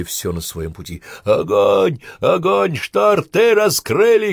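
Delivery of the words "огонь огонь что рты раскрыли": high, loud commander's yell; full battle shout, drawn out at the end. A narrator's reading. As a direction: bellow orders at gaping soldiers. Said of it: "кричал Иванцов".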